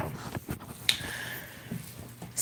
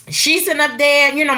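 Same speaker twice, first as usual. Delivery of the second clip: ordinary talk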